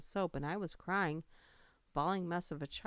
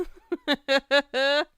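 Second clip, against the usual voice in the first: bursts out laughing hard; big helpless laugh; loud real laugh; 9 semitones higher, 15 dB louder than usual. Real, not acted. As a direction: cackle loudly at the chat. Streamer laughing at chat.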